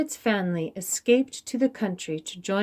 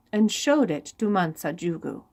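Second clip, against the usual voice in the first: ordinary delivery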